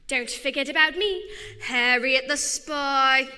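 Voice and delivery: put-on bright girl sleuth cutting in, slow on her name; show-off girl detective making her entrance; eager excited girl detective. Rival girl detective butts in.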